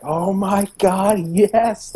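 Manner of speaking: high, drawn-out giddy exclamation; giddy excitement. Retold or imitated. Podcaster reliving a first reaction to a game trailer, giddy. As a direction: gush slowly with rising delight. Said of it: "really giddy".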